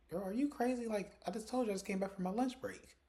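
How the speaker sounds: high-pitched, incredulous retort; retold indignant comeback; indignant disbelief. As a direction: act out an exasperated denial, pitched well up. Retold argument, indignant denial.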